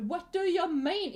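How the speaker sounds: loud, high, exasperated protest; mock outrage. Scolding English speakers for mangling Swedish, mock outrage. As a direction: object loudly, pitch jumping up.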